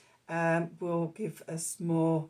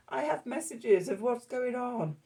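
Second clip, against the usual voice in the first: lightly voiced, higher-pitched herald; mock herald; 7 semitones higher than usual. Knight of Cups bringing in messages, lightly played herald. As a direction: announce it with a hint of a herald. Plays the herald after the fanfare.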